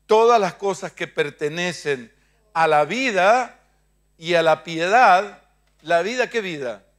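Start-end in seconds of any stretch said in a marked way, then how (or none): 0.1-5.4 louder, slow and drawn out; loud repeat-after-me. Shown as a demonstration. Preacher leads the church in repeating the verse, loud and slow. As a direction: call it out for all to repeat.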